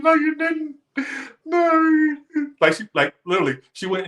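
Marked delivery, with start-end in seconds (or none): 0.0-2.2 much higher, louder mock wail of disbelief; imitated ugly cry. Sister hearing her gaming computer arrives Wednesday, imitated ugly cry. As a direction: squeal it like a sister overwhelmed with joy. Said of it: "And she started crying".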